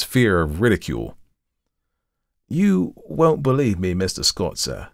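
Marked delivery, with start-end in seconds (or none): 2.5-4.9 lightly voiced hesitant Cockney; Thomas. A narrator's reading. Thomas fears ridicule from his employer, in reluctant Cockney. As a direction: start high and slow, drop away, in Cockney. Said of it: "had not only modified Thomas's Cockney dialect".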